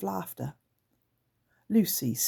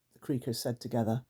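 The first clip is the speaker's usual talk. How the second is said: usual speaking voice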